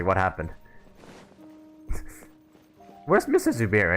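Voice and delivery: ordinary talk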